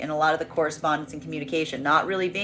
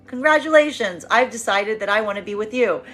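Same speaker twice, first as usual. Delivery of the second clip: self-important announcement; 3 semitones higher than usual. Acted. Old flame returns to feminine, grandly announcing his decision. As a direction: announce it like a prize being awarded. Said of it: "old energy coming back to a feminine right now that's being like".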